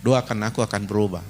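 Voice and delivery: low, quiet husband's apology; hushed tender apology to wife; soft apology. Acted. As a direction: say sorry gently and low. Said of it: "Dia bilang".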